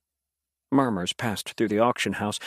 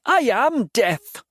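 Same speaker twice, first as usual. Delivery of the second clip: slow, loud, high proclamation; menacing boast; 11 semitones higher, 5 dB louder, much slower than usual. A narrator's reading. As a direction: draw out each word like a threat. Demon boasting after snarl.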